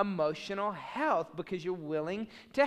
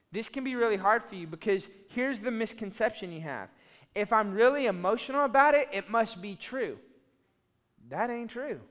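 ordinary talk

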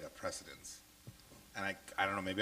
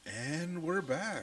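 drawn-out, higher sing-out to listeners; show-host return; 8 semitones higher, much slower than usual. Real, not acted. Host reopening the show after a break, drawn-out announcing. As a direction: stretch it out like a host back on air.

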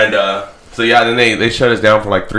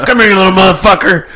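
higher, louder playful yell; mock shout; 9 semitones higher, 7 dB louder than usual. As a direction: chase them with a laughing yell. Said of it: "Just go in there".

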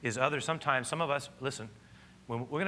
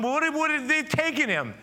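Mary's confusion; 13 semitones higher, 6 dB louder than usual. Acted. Pastor voices Mary's confusion.

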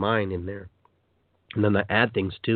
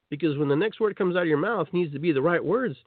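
normal talking voice

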